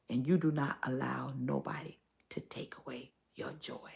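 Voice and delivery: whisper. Real, not acted. Urging lost joy back.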